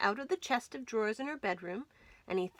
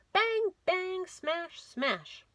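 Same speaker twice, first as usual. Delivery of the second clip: banging smash; 9 semitones higher, much slower than usual. A narrator's reading. Tom Thumb smashing the plaster ham.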